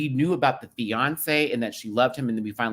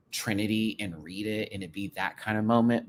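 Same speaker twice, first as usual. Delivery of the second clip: usual speaking voice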